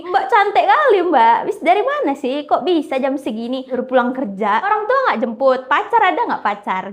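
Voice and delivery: high, loud, cheeky flirting; teasing flirt. Retold or imitated. Bang Jack flirting with the woman on the bike, loud and cheeky. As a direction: tease her playfully, pushing for an answer.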